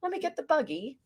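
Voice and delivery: high, soft posh voice; quietly dainty posh accent. Acted. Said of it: "It sounds all posh".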